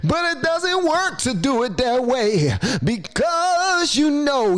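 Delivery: high, drawn-out mock orator; lifted preacher voice. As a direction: lift your voice and stretch it like a preacher. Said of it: "you gotta lift your voice".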